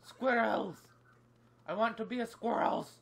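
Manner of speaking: loud, high playful shout; silly yelling. Pretend. Gamer yelling about squirrels for fun, loud and high. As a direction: blare it out goofily. Yells silly exclamation in play.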